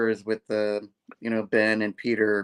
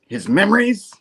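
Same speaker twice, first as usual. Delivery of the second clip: higher, louder retold question; mock counselor question; 8 semitones higher, 7 dB louder than usual. Acting the counselor questioning Janine, mock raised voice. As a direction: imitate a counselor asking, pitched up. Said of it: "counselor's like".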